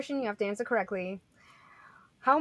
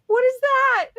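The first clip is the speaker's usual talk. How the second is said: high, loud startled cry; startled shout; 12 dB louder, slower than usual. Real, not acted. Host spotting a puppet on camera, startled cry. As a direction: blurt it out in shock. Sudden shock at seeing puppet.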